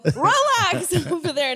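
loud, high laughing tease. Real, not acted. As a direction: tease him while laughing.

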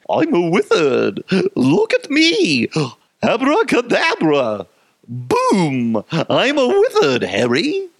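high, hammed-up wizard shriek; mock boy wizard. Wizard showing off spells to Harry, high silly voice. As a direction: crow it like a silly excited wizard. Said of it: "this reminds me of Harry Potter".

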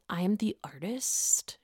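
slow whispered self-talk; mock Candace. Candace calming herself in her head, slow mock whisper. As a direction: whisper it to yourself, imitating strained composure. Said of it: "trying to calm herself down".

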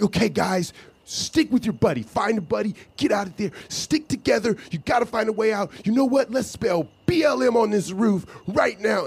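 high, bossy rallying calls; lightly voiced pushy leader; eager leader voice. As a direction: play the overeager guy taking charge. Eager self-made protest leader.

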